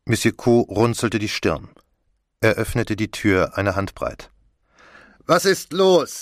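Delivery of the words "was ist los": sharp command, pitched much higher. A narrator's reading. Commissioner answers a knock at the door, commanding. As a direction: demand it like an officer interrupted. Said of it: "sagte er im Befehlston".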